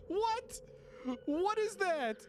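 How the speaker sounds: high-pitched baffled question; high, incredulous exclaiming; amazed disbelief. Real, not acted. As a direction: ask it high, in real astonishment. Amazed by thing in game.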